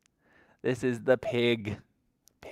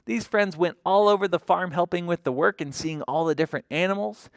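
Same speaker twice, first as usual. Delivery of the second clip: ordinary delivery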